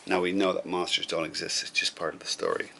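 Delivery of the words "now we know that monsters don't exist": ordinary delivery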